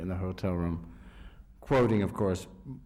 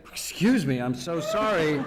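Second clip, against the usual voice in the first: mock apology; 6 semitones higher, 5 dB louder than usual. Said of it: "they had to say".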